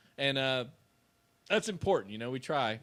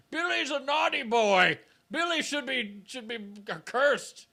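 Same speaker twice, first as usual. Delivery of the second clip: high, slow, loud menacing creature voice; zombie Santa; 10 semitones higher, 6 dB louder, slower than usual. Pretend. Imagining zombie Santa scolding a naughty kid, high and slow. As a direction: growl a curse as an undead Santa.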